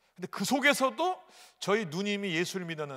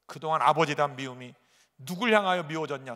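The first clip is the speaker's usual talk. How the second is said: ordinary delivery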